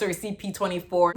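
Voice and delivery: normal talking voice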